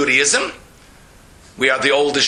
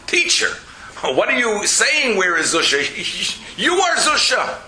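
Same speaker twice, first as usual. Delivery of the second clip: students questioning; 6 semitones higher than usual. Retold or imitated. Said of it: "they said".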